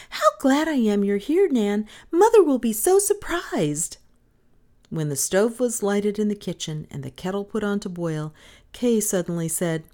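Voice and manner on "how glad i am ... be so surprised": put-on high, loud, gleeful little girl; joyful child's cry. A narrator's reading. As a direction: gush happily in a high girl's voice. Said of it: "she cried".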